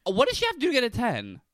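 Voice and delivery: high, fast eager question; excited. Real, not acted. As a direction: ask it eagerly.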